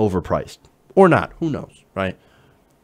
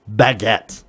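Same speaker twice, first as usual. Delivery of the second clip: ordinary talk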